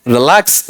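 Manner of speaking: Relax with a light first syllable and a rising, stressed लै; weak रि, then push up on lakhs; iambic stress. Shown as a demonstration. Teacher demonstrates an iamb, relax stressed on the second syllable. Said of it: "unstressed followed by stressed".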